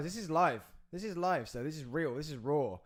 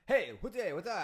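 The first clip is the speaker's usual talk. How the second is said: hammed-up mock Italian, a little higher and louder; a light cartoon Italian; 6 semitones higher, 3 dB louder than usual. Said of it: "Do you reckon they just go like".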